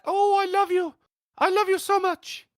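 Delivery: high lady voice for the husband. Retold or imitated. Husband cooing to wife in a lady voice. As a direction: coo it like a sweet lady. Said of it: "I gave him the lady voice".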